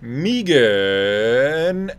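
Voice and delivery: loud, slow, stretched ring call; Bruce Buffer call. Retold or imitated. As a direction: boom the name out long. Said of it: "Bruce Buffer has the microphone".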